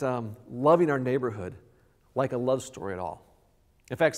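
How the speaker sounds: ordinary delivery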